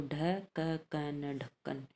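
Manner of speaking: ढ क क न spoken slowly letter by letter, then ढक्कन whole; spelling it out. Shown as a demonstration. Teacher spelling ढक्कन for the class, slow and deliberate. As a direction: sound out ढ क क न, then join into ढक्कन. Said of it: "teacher spells word by letters".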